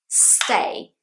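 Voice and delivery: "stay" starting straight on a long S; one-syllable stay. Shown as a demonstration. Teacher models the verb, one-syllable "stay". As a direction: hiss into "stay" in one beat, no vowel before. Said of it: "is just one syllable".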